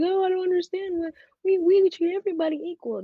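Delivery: high, put-on mimicry of excuses; mocking imitation. Imitating school staff claiming equal treatment, mockingly high.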